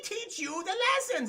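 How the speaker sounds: high, theatrical villain voice; shrill pompous sewer ruler; Sewer King voice. Retold or imitated. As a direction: hiss it like a creepy cult leader. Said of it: "And he's like".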